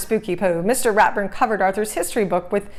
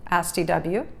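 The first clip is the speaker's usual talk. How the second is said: ordinary delivery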